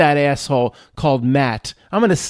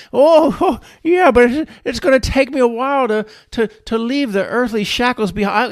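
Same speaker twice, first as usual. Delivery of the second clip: put-on groggy old man; 6 semitones higher than usual. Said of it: "stop talking like you're an old man".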